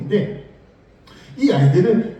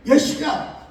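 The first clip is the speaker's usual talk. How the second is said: imitating a grown-up snapping at a kid, higher; playful scold, pitched up; mock scolding; 8 semitones higher than usual. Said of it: "우리 옛날에 그랬죠". (아이를 꾸짖는 어른 흉내, 장난스럽게 높은 톤으로)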